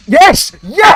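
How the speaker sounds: high, loud yes of victory; triumphant yell. Real, not acted. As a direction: cheer the win at full voice. Victory after boss fight.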